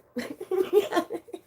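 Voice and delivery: high-pitched burst of laughter; giggling at her own joke; high giggle. Real, not acted. Streamer laughs at her chat joke, high giggle.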